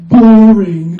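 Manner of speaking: loud flat call of Boring; called-out heckle. Retold or imitated. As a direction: shout Boring from the back. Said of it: "Jake calls out".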